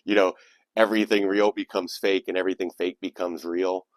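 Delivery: ordinary talk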